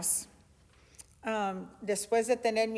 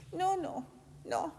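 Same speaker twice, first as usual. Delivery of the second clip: whisper; 7 semitones higher, 8 dB quieter, slower than usual. Real, not acted. Whispered rebuttal.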